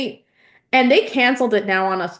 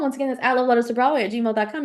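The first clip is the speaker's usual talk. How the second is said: rattled off quickly; fast; much faster than usual. Shown as a demonstration. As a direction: speed through the address. Fast repeat of email address.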